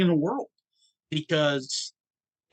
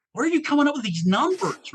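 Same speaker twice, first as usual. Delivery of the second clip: retold high, louder disbelief; incredulous question; 13 semitones higher, 5 dB louder than usual. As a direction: voice leaps up in mock astonishment. Retold reaction to kids' high numbers.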